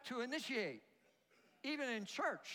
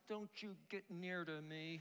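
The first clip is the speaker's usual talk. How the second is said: low-key muttered warning, much softer; standoffish; 3 semitones lower, 7 dB quieter than usual. Acted. Preacher voicing unfriendly people's hidden meaning, quiet standoffish warning. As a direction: keep them away under your breath. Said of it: "they're not saying, Good morning".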